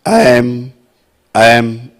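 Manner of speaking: एम, ending on closed lips; एम, as a mantra syllable with closed mouth; closed "m" sound. Shown as a demonstration. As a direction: hum the m of एम,. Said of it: "ऐसे".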